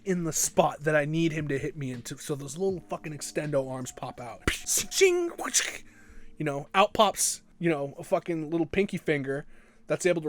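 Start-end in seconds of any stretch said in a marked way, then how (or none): 4.9-5.3 much higher ching sound effect; metallic ching. Retold or imitated. Kid imitating extendo arms popping out, a high ching. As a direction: snap out a high clink.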